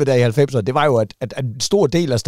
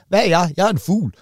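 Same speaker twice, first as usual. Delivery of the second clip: hopeful penguin; 7 semitones higher than usual. Retold or imitated. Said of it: "pingvinen ... ved Gud".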